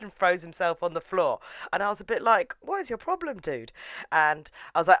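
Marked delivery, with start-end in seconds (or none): 2.6-3.7 mock incredulous. Narrator's mock reaction to character.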